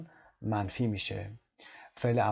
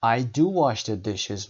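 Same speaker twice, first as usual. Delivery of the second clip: "do" hit loud, whole line slow; stressed do; 4 dB louder, much slower than usual. Shown as a demonstration. Teacher modelling emphatic "do", slow and clear. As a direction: press on "do" before wash.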